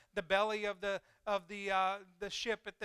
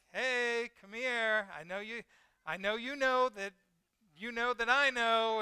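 higher, knowing parental call; parent calling child; 3 semitones higher, 5 dB louder than usual. Acted. Parent to hiding child, knowing call-out. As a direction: call out to a child you have caught.

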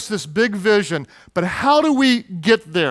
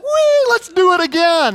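put-on much higher, louder thrilled little-boy voice; delighted child; 10 semitones higher, 4 dB louder than usual. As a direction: squeal for another go. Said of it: "He's like".

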